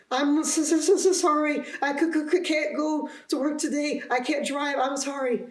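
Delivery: high, stammering, frightened apology; scared. Retold or imitated. Shaking patient phoning work to apologise, scared. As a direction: re-enact a panicked, shaky phone call in a high voice. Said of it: "You sounded so scared on the phone".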